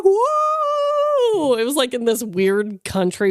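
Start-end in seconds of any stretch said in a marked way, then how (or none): 0.0-1.6 high, stretched mock crowd whoa; crowd's whoa. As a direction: play the whole bar gasping in surprise. The crowd reacts to her.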